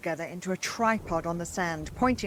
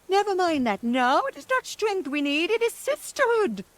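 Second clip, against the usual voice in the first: high, loud hag cry; very high shrill-pitched witch cry; 12 semitones higher, 4 dB louder than usual. Acted. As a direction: cry out for sisterhood. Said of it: "cried the second sister".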